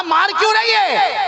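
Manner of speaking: high, startled why are you hitting; husband struck by a flying pot, crying out; startled protest. Acted. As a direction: yelp it out in shock.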